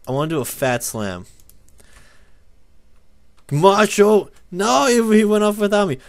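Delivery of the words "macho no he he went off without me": high, loud cry of dismay; raised-voice frustrated complaint; shouted protest. Real, not acted. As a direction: yell in exasperation as it goes wrong. Move misfires in game.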